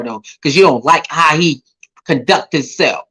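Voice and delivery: ordinary delivery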